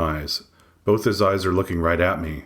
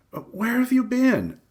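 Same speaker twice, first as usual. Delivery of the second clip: put-on much higher, slow old lady; higher, slow grandma on the phone. A narrator's reading. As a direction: ask slowly like a worried grandmother.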